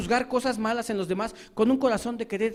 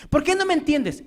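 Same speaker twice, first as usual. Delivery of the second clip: higher accusing question; mocking reproach; 7 semitones higher, 4 dB louder than usual. Preacher mimicking a spouse demanding understanding, accusing. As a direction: complain it sharply.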